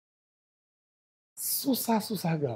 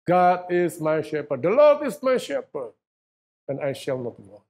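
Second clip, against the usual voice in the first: proclaiming; 2 semitones higher, 5 dB louder, slower than usual. Acted. Preacher proclaiming the psalm to the congregation, slow and loud. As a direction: declare it slowly and loudly, building up.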